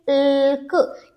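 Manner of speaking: इल्क stretched very slowly; sounding out. Shown as a demonstration. Teacher sounding out the end of milk slowly. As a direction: drag out इल्क to show the sound. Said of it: "के sound follow कर रहा है".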